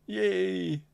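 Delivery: a high cheer; excited cheer. Real, not acted. Streamer cheers that the gadget worked, high and excited.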